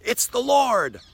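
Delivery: high, loud cry of recognition; excited exclamation. Retold or imitated. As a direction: blurt it out in amazement. John recognizes Jesus excitedly.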